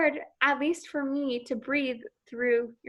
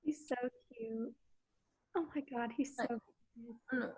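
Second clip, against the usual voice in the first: soft cooing; 12 dB quieter than usual. Real, not acted. Cooing at the puppy.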